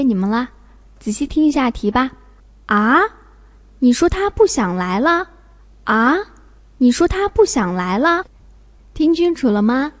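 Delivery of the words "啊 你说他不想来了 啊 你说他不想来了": rising "ah", then incredulous question. Shown as a demonstration. Teacher reading the last quiz sentence, questioning. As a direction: query it in disbelief, voice lifting.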